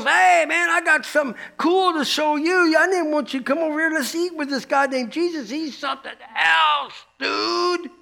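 high, excited laid-back dude voice; surfer-dude buddy. Retold or imitated. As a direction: call your buddies over like a hippie dude. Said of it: "He goes".

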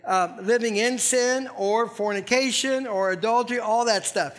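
ordinary talk, nothing marked

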